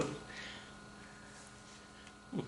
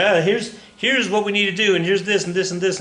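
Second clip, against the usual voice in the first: higher-pitched candidate pitching with flip charts; Ross Perot; 6 semitones higher than usual. Retold or imitated. Teacher imitating candidate presenting flip charts to camera, light impression. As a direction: lightly mimic a politician's eager presentation, voice lifted. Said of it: "and he'd say".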